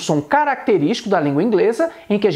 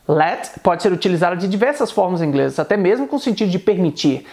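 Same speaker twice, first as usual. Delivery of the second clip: ordinary delivery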